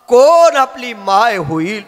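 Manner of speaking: slow, high, loud crying question; anguished plea. Acted. Muktabai asks her brother who will be their mother, anguished plea. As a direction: draw it out in grief.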